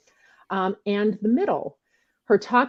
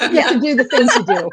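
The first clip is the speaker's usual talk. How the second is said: said through a laugh, louder; 5 semitones higher, 6 dB louder, faster than usual. Real, not acted. Host laughing at the guest's pajama story, laughing. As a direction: laugh the line out.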